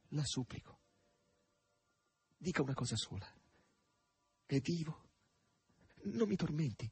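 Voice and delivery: soft, halting plea; imploring. A narrator's reading. Margherita begs to know whether he is alive, imploring. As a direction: beg quietly, pausing between phrases. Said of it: "sussurrò Margherita con tono implorante".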